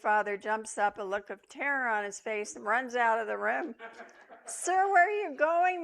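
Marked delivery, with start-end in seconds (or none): 4.4-5.8 raised, much higher call after a fleeing man; nurse calling out. A narrator's reading. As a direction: call out loud and high across the room. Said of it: "the nurse calls out".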